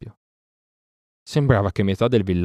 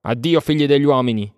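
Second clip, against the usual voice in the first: raised, louder defiant farewell; scornful shout; 6 semitones higher, 5 dB louder than usual. A narrator's reading. Mowgli leaving the sons of men, shouting scornfully. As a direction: call out loudly with contempt, higher. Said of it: "gridò Mowgli sprezzantemente".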